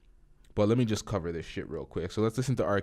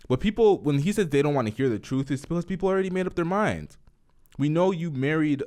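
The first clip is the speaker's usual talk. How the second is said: louder, higher, worked-up ranting; heated rant; 6 semitones higher, 5 dB louder than usual. Real, not acted. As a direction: push it out like you're fed up. Heated rant about fans.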